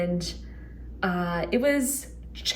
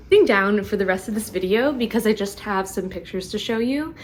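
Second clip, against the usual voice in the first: normal talking voice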